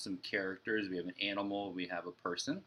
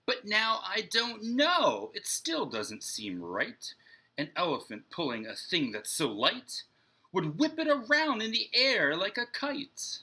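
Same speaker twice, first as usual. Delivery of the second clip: excited, much higher and louder storybook reading; rising wonder in a picture-book read-aloud; animated reading to children; 8 semitones higher, 7 dB louder, slower than usual. A narrator's reading. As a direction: ham up the growing daydream for kids.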